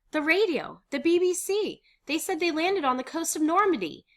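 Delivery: high, loud, elated; excited report. Acted. Miep explaining the radio news, excited. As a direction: announce each fact with excitement.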